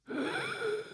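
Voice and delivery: high startled gasp, airy and soft; the caged bird gasps in fright at the open door; sharp gasp. Pretend. The bird's reaction to freedom.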